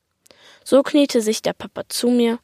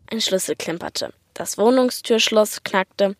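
usual speaking voice

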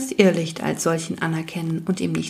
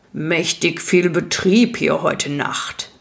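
grind the words out slowly like rock; creaky rock-biter voice; slower than usual. Acted. Rock biter greets the newcomer by the fire, slow creaky rumble. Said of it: "sagte er mit knarrender Stimme".

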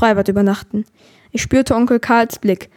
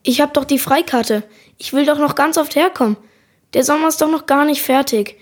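put-on higher-pitched, plaintive child's plea; pleading protest; 5 semitones higher than usual. A narrator's reading. Katinka pleads to keep coming, pleading protest. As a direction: protest against the ban, higher voice.

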